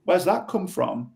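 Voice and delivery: high, surprised echo of their words; gentle and puzzled, asking where it came from; curious mirroring. Shown as a demonstration. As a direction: reflect it back with open curiosity. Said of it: "just hold the mirror back up".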